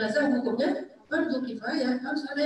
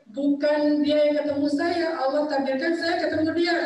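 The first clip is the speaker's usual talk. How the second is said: higher and louder, stressing "dia" and "saya"; emphatic; 4 semitones higher, 4 dB louder than usual. Real, not acted. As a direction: drive the point home with force. Punchline of his story.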